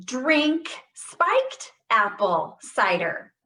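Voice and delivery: slow, every word stressed, a joke slipped in; joking drill line. Shown as a demonstration. Therapist joking during a voice drill, slow stressed words. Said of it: "That was a joke".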